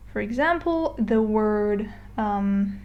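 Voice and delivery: normal talking voice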